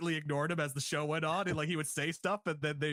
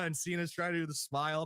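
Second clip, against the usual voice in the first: ordinary talk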